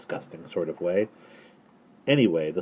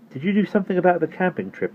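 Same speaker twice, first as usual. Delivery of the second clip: put-on boy character voice; 11 semitones higher, faster than usual. Boy Omri voiced in story.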